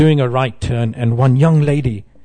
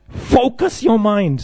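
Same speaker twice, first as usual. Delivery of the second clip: high, slow Scottish instruction; Scottish accent; 8 semitones higher, slower than usual. Retold or imitated. Scottish monk drills devotee on japa, Scottish accent. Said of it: "very good at Scottish accent".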